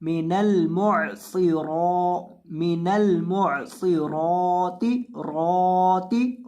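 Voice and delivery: रॉती with the ra held one alif long and heavy; slow recitation, heavy saad and long ra; lengthened ra. Shown as a demonstration. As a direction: stretch the रॉ in मुअसिराती, thick saad. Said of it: "रॉ को लंबा करेंगे".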